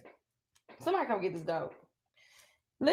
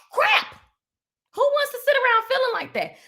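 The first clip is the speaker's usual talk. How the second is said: squealed disgust; 10 semitones higher than usual. Real, not acted. Speaker mocking sitting around feeling bad, squeaked higher. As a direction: jump way higher on the word, squeaky.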